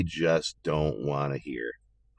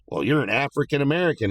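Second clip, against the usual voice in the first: mocking quote; 7 semitones higher than usual. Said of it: "they're like".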